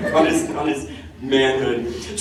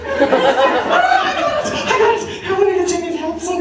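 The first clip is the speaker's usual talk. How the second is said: high, loud imitation of a keen stranger; overeager helper; 3 dB louder than usual. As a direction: shout it in comic eagerness. Imitates eager stranger on set.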